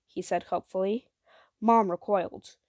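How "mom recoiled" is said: ordinary delivery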